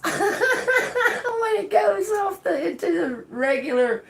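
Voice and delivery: high, slow squeak through laughter; laughter bursting through, then settling; failing to hold laughter. Real, not acted. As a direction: push words out through the giggles. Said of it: "I'm trying not to laugh".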